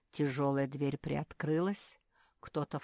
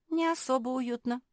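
high-pitched dismissive snort; 8 semitones higher, 4 dB louder than usual. A narrator's reading. Mafi recalling her childhood cellar, snorting. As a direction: toss it off with a light snort. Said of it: "фыркнула Мафи".

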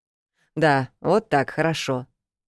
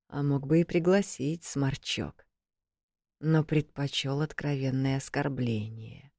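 musing quietly; 7 dB quieter than usual. A narrator's reading. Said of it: "задумчиво произнёс магистр куда-то в пустоту".

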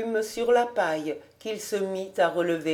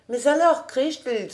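usual speaking voice

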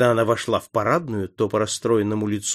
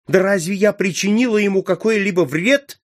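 higher, louder indignant protest; indignant cry; 10 semitones higher, 6 dB louder than usual. A narrator's reading. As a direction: countess protesting she harmed no one. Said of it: "крикнула графиня". (возмущённый высокий громкий выкрик)

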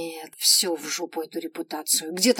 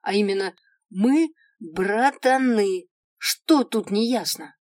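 irritated outburst; 3 semitones higher, 7 dB louder, slower than usual. Retold or imitated. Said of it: "Разозлилась и с психу выдала".

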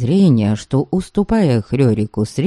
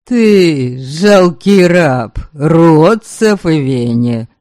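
contemptuous; 3 dB louder, much slower than usual. Acted. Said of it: "отвечал ему Каури".

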